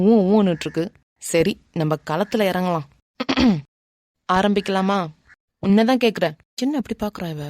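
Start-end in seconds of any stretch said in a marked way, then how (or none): none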